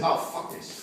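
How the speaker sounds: normal talking voice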